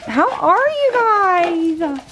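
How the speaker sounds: very high, slow, crying coo. Real, not acted. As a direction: coo to the puppies like babies. Greeting puppies.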